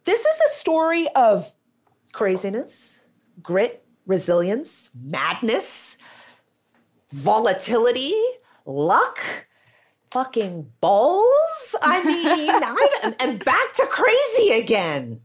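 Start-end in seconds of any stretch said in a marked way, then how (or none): 0.0-1.5 high, giddy thanks; high giddy gratitude; excited. Real, not acted. Thanked, excited laughing disbelief. 10.1-15.2 slow punchline, then laughing high; high laugh after a slow punchline. Real, not acted. Laughing at own wild story.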